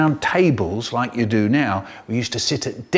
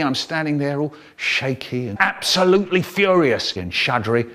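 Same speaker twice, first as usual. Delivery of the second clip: plain reading voice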